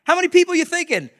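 high, loud, eager go-ahead; retold gung-ho enthusiasm; getting excited. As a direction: fire it off, caught up in the plan. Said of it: "He's kinda getting excited".

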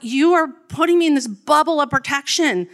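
retold frustrated shout; frustrated outburst. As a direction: snap back in exasperation. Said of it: "really frustrated".

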